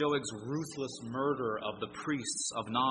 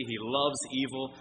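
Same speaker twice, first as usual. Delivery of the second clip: ordinary delivery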